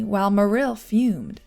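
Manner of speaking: ordinary delivery, nothing marked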